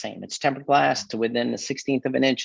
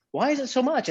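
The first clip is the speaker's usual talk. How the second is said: very high mock complaint; complaining customer; 12 semitones higher, 4 dB quieter than usual. Glazier imitating customers balking at the price, high-pitched complaint.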